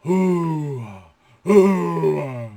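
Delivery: loud mock cattle bellow. Shown as a demonstration. Teacher imitating a bull for vocabulary, loud bellow.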